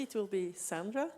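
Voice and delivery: normal talking voice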